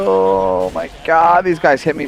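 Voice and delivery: exasperated. Real, not acted. Healer groaning to his teammate about enemies in the back, slow exasperated. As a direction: stretch it out, overwhelmed.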